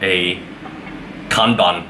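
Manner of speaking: usual speaking voice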